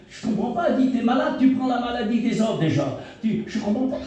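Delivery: high, quick imitation of the wife; lightly voiced bewildered wife; mock puzzled wife. Wife puzzled that he suffers for the sick, high and quick.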